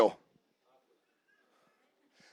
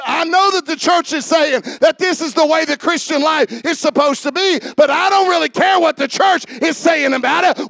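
rising, louder preacher's call; fervent pulpit preaching; 5 semitones higher, 5 dB louder than usual. Acted. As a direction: build the sermon up to a shout. Preaching at full force.

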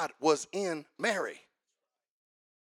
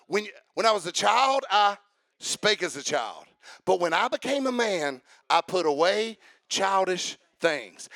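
full-force preaching; 7 dB louder than usual. Real, not acted. Preacher firing up the congregation, shouting. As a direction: preach it to the rafters, building to a shout.

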